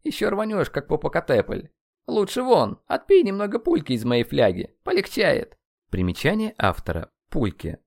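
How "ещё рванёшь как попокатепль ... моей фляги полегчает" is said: high-pitched, lively coaxing; high, jocular character voice; teasing friend. Acted. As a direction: coax a hot-headed pal to take a swig. Friend teases and offers a drink.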